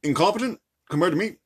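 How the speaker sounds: lightly voiced halting president; Barack Obama. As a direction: pause between phrases like a statesman at a podium. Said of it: "I could do an Obama accent".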